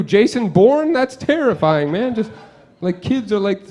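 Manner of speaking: ordinary talk, nothing marked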